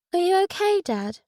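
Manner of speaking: high, lightly voiced child; child's tentative question, pitched high; little girl. A narrator's reading. Daughter checks on her father, in a high child's voice.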